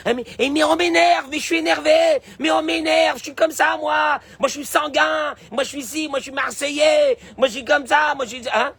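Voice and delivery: high, loud hammed-up rant; mock angry outburst. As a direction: play the hothead who can't help it. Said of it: "quand je crie comme ça". (colérique imité, crié)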